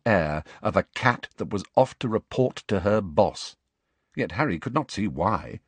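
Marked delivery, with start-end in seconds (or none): none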